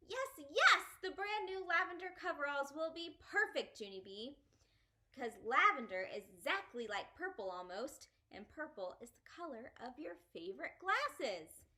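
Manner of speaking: high, loud happy cheer; thrilled shouting stuffed elephant; joyful shout. Acted. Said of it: "We shouted real joyful".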